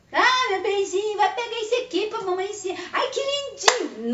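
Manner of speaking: put-on high, gushing baby talk; mock doting mother. A mother fawning over her child, in high gushing baby talk. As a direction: coo it like a smothering mom. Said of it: "Não babado em cima".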